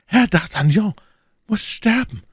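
high-pitched, forceful lady's voice; vengeful cry. A narrator's reading. Milady demanding D'Artagnan's death, high vengeful cry. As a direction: call out a death sentence in a high voice. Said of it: "rief My Lady mit dumpfer Stimme".

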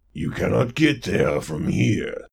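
slow monstrous croak; slow croaking creature voice; toad lord. Acted. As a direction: drawl it like a swamp god. Lord of Muck speaks.

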